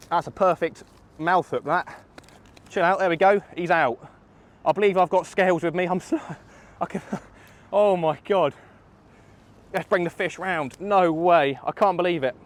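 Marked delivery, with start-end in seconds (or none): 5.8-8.5 laughing through the words; overjoyed angler laughing; giddy laugh. Real, not acted. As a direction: giggle with disbelief. Laughing after the catch.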